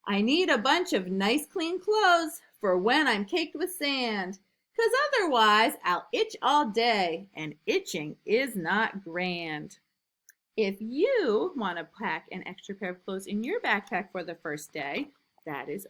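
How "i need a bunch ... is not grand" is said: lively, louder storybook reading; reading to children. A narrator's reading. Teacher reading a picture book to new kindergartners, lively and loud. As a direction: read it big and bouncy for little kids.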